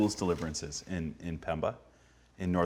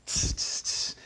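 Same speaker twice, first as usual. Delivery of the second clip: short repeated hisses; snake strike noise; 4 dB quieter, slower than usual. Retold or imitated. Deadly snakes striking at his arms, quick hisses. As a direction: imitate vipers striking, tss by tss. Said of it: "they're just striking him".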